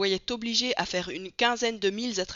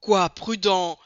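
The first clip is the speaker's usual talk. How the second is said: indigné; 8 dB louder, slower than usual. A narrator's reading. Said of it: "s'écria le colonel Proctor".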